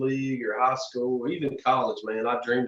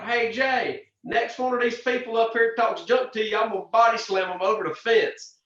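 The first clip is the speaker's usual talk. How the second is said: big friend's threat; 9 semitones higher, 4 dB louder than usual. Retold or imitated. Friend voiced threatening hecklers.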